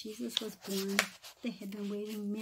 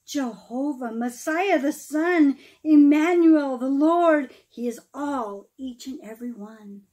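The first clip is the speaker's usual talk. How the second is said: lively storybook read-aloud, rising and falling; storyteller reading verse aloud; reading to children; 4 semitones higher, 13 dB louder than usual. Acted. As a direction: read the Christmas rhyme to a room of kids. Reads Christmas poem to children.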